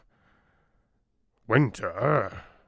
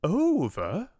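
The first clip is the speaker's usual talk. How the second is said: high, piping tiny fly voice; little fly; 8 semitones higher than usual. A narrator's reading. Tiny fly questions the oak, high piping voice. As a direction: squeak it out like a tiny insect. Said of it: "repeated the fly".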